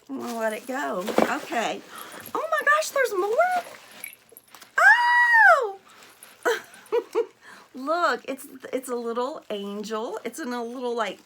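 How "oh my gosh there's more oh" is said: high, loud squeal of surprise; thrilled, very high-pitched exclamation; astonished delight. Real, not acted. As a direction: squeal up into a shriek. Finds yet another gift inside.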